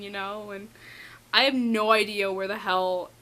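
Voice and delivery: ordinary talk, nothing marked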